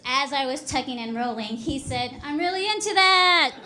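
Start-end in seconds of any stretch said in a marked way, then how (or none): 2.2-3.5 loud, high imitation of an eager man; eager mock yell. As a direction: call it out high and loud, hammed-up. Acted eager reply of flirting friend.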